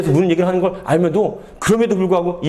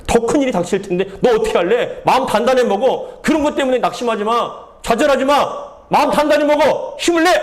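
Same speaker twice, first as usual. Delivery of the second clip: building to higher, rousing exhortation; God's urging; 5 semitones higher than usual. Acted. God tells the weary prophet to take heart, building rousing exhortation. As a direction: urge them on, climbing in pitch with each command. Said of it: "그 말씀하시는 거예요".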